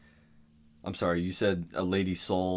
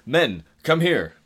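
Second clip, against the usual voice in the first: calling out; 5 semitones higher, 6 dB louder, much slower than usual. Acted. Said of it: "Davis came out of his house and said".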